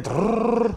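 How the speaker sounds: high rolled-tongue motor noise; imitated machine whirr. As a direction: trill it like a machine running. Imitates the ice cream machine. (ruido de máquina imitado)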